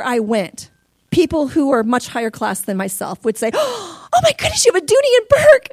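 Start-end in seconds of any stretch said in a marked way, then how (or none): 3.5-5.7 high, gasping admiration; gushing socialite. Retold or imitated. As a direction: gasp and gush like a posh lady. Impressed high-class people.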